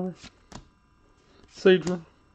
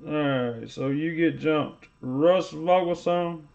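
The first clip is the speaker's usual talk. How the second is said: ordinary talk, nothing marked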